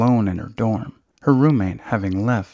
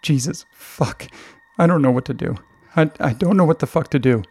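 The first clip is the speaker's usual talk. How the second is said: acted panic, raised pitch, stumbling into a rush; choked, breathless; 4 semitones higher than usual. A narrator's reading. Mother panicking on the phone to her daughter, choked and breathless. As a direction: play a distressed mother at a loss. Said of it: "her voice choked and breathless".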